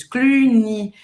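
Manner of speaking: Cluny, rounded French u, y as i; Cluny said clearly the French way; French pronunciation. Shown as a demonstration. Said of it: "o Y tem som de I em francês".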